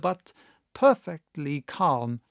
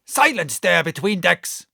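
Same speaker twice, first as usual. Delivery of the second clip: louder rough bark at the room; villainous oath; 3 semitones higher, 6 dB louder than usual. A narrator's reading. Drunk captain silencing the doctor with a loud oath. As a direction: snap it out like a pirate captain. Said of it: "broke out with a villainous oath".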